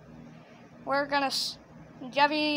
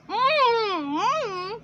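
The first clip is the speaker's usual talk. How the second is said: high, slow closed-mouth humming moan; muffled moan; 7 semitones higher than usual. Shown as a demonstration. Director showing Jeffy with arms in mouth, high muffled moan. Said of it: "Jeffy is gonna be like".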